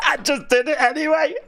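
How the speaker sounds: high laughing words. Real, not acted. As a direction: speak through the laughter. Laughing after timeout.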